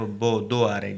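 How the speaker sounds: ordinary talk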